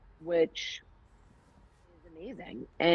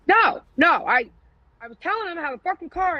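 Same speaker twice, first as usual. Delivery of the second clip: higher, fast flustered protest; 11 semitones higher, 4 dB louder than usual. Retold or imitated. Defending herself to the therapist, higher flustered rush. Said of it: "I'm like".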